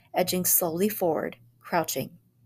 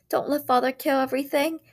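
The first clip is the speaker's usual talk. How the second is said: put-on wailing child; 7 semitones higher, 3 dB louder than usual. A narrator's reading. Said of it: "wailed Peter".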